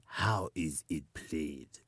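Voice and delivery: slow, low, soft growl; panther voice. Acted. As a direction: ask slowly like a big cat. Leopard voiced.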